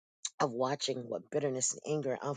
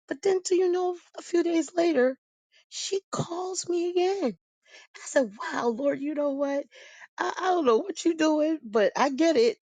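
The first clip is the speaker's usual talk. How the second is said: much higher and louder, breathless wonder; amazed retelling; 12 semitones higher, 5 dB louder than usual. Real, not acted. Telling listeners of her mother's call, high amazed excitement. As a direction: marvel at what God is doing.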